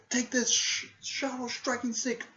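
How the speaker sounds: high battle cry, drawn out on the move's name; shouted attack call. Retold or imitated. Character calls out a special attack.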